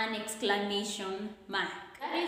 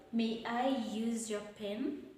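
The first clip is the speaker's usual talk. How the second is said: ordinary talk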